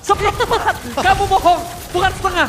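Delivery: loud playful protest; excited. Real, not acted. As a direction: call out the co-caster teasingly. Playful correction of co-caster.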